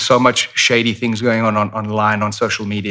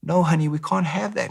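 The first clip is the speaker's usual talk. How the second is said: gentle mom voice; 7 semitones higher than usual. Retold or imitated. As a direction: calmly say no to a child. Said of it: "the mom is like".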